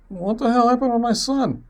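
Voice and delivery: high-pitched imitation of a mother demanding answers; mock alarmed mom, voice jumping up high; upset mom. Mom questioning dad about her son, mock upset. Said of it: "And she's like".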